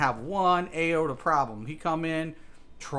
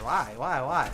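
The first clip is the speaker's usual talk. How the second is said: normal talking voice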